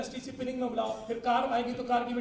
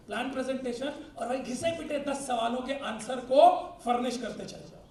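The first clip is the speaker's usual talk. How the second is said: usual speaking voice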